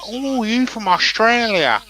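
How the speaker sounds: high, slow, mock American lady; drawn-out American tourist impression; imitated American accent. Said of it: "That's not a bad accent".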